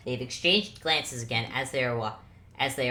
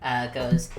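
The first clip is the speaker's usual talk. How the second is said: usual speaking voice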